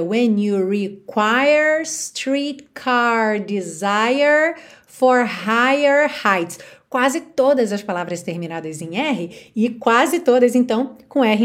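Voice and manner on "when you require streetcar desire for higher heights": slow American line with every final R curled; American accent. Shown as a demonstration. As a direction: curl each R slowly. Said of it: "no americano a gente teria". (sotaque americano com R enrolado)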